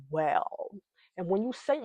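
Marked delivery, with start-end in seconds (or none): none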